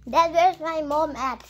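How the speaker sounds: loud call to another room; yelling across the room. Real, not acted. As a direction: shout it out to dad. Calling to dad in another room.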